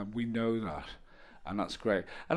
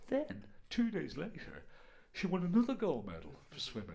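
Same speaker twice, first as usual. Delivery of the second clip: softer, measured comic set-up; understated telling; 5 semitones higher, 8 dB quieter than usual. Shown as a demonstration. Comedian revealing the swimmer's second win, quiet deadpan. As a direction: let it drop quietly, taking your time.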